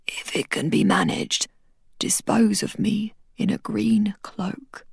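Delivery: slow, soft, failing voice; dying man asking Tariq for a green cloak, whispered; wheezing whisper. Acted. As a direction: whisper a last request. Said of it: "As he wheezed".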